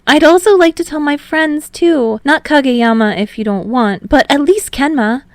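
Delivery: bubbly, quick and eager; excited Hinata. Acted.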